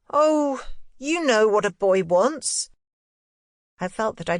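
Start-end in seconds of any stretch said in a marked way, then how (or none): 0.1-2.7 put-on high, knowing boyish voice, slow; a boy playing grown-up; boy Miles. A narrator's reading. Boy teasing his governess, high and knowing.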